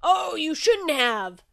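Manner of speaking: drawn-out delighted thanks; booming shark host gushing over a gift. Acted. Shark receives the present.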